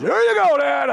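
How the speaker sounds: put-on high, loud boyish celebration; retold excited cheer. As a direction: cry out in triumph to Dad. Son celebrates great shot.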